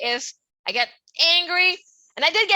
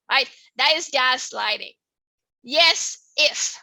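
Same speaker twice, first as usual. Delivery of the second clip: usual speaking voice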